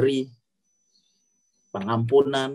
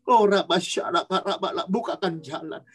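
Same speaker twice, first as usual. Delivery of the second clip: rising prayer blessing, higher and building; fervent prayer; 5 semitones higher than usual. Acted. Sister prays blessing over the children's heads, rising fervent prayer. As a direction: call down the blessing on them.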